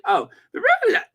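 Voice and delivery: high, loud startled cry; sudden high exclamation; surprised squeal. Real, not acted. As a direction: jump at what she sees. Reacts to a caption.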